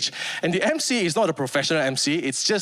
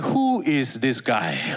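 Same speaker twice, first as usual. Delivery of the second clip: skeptical drawl; 6 semitones lower, much slower than usual. Retold or imitated. Skeptical VIPs, voiced.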